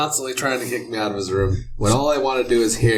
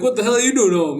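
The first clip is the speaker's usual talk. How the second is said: higher, mock-startled demand; mock outburst; 7 semitones higher, faster than usual. Roommate catches him at home, mock surprise. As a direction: imitate an indignant roommate.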